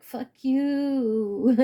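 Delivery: drawn-out mock rapper impression. Telling off a false friend, lightly imitated rapper voice. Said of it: "In my [name] voice".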